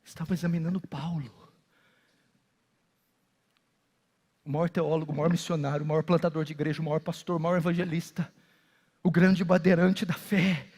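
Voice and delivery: low, soft sermon voice; quiet, grave preacher; hushed solemn preaching. Real, not acted. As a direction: drop low and soft for weight. Hushed, weighty list of Paul's titles.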